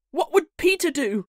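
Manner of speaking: put-on high, loud childlike call; boys' urgent cry. A narrator's reading. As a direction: cry out urgently as a gang of boys. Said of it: "they cried simultaneously".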